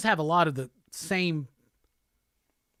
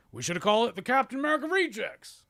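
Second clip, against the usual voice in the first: higher-pitched put-on quip; rising, put-on jokey voice; movie quip; 6 semitones higher, faster than usual. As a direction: deliver a corny scripted joke. Imagined joke line from the film.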